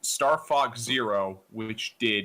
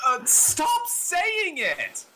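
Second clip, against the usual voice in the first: high pleading shout of disgust; yelling to make it stop; much slower than usual. Real, not acted. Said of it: "you sound like you're about to die".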